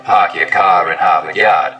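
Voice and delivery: non-rhotic Boston accent, no R sounds in "Park Your Car in Harvard Yard". Shown as a demonstration. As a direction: drop every R like a Bostonian. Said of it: "Stereotypical Boston accents, of course, are non-rhotic".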